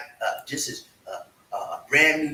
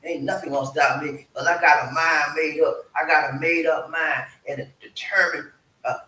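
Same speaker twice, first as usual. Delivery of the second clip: ordinary talk, nothing marked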